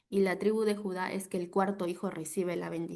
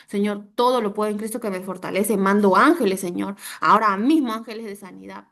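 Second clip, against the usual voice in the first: loud declaration building to a shout; forceful prayer; 8 dB louder than usual. Shown as a demonstration. Preacher demonstrates praying for the sick, forceful and loud. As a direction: command it in prayer, at full volume.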